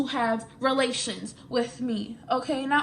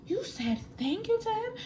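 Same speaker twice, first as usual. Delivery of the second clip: much higher, softer disbelief; incredulous boyfriend; 9 semitones higher, 5 dB quieter than usual. Retold or imitated. Imitating her boyfriend, shocked she thanked the boy. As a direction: ask it in high disbelief. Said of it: "he was like".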